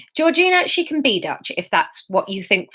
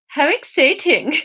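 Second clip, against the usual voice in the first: put-on higher, shaky-footed Swedish accent; bad Swedish accent; 5 semitones higher than usual. Narrator voices Anya reacting to romance, bad Swedish accent. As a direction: attempt a Swedish accent, badly. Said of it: "This is the worst accent yet".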